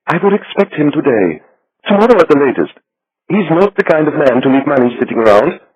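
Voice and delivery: little Swiss banker. A narrator's reading. Becker predicts Wolf.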